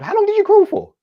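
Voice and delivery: a squeaky, amazed retold question; mock astonishment. Friend asking how long he crawled, mock amazed squeak.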